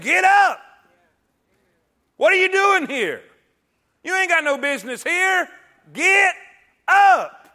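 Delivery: shouted, high get up; divine rebuke. Acted. God rousing suicidal Elijah, shouting high and loud. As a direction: shout him off the ground.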